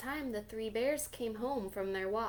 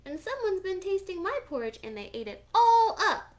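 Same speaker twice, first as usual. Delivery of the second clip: put-on higher, louder, fast baby bear squeal; wee little voice; 9 semitones higher, 9 dB louder, faster than usual. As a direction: squeal it like a tiny upset cub. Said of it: "squealed little Baby Bear in his wee little voice".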